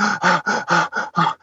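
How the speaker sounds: quick mock panting; imitated heavy breathing. Imitating his partner after her panic, heavy mock breathing. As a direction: pant heavily, imitating someone recovering from panic. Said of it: "she's, like, breathing heavy".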